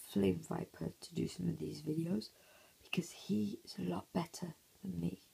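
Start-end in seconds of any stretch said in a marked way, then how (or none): none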